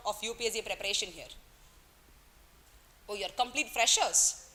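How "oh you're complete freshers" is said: ordinary talk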